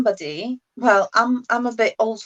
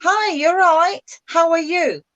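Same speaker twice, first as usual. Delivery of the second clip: higher, slow and cheerful "Hi, how are you?"; friendly greeting; 7 semitones higher, 3 dB louder than usual. Shown as a demonstration. Tutor acts out greeting a friend warmly. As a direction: greet a friend in the street brightly. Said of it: "if you meet a friend".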